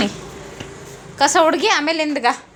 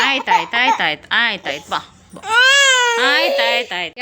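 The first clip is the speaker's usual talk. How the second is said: low, repeated hushing with falling tune; soothing; 4 semitones lower than usual. Real, not acted. Parent soothing crying toddler. (ಅಳುವ ಮಗುವನ್ನು ತಗ್ಗಿದ ದನಿಯಲ್ಲಿ ಸಮಾಧಾನಿಸುವುದು)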